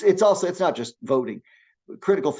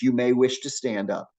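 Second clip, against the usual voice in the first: usual speaking voice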